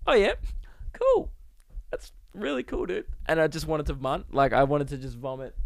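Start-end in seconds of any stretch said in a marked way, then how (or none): 0.1-3.1 voice went high. Retold or imitated. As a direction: imitate your voice betraying you as you feign interest. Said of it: "my voice went".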